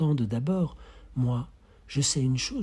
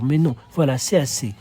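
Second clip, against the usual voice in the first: ordinary talk, nothing marked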